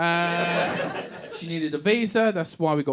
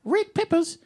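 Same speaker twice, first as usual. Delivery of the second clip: Australian wife; 11 semitones higher than usual. Retold or imitated. Wife corrects husband's word for peppers, mock Australian wife. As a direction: mimic a patronising Aussie wife, pitched right up. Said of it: "And she'll be like".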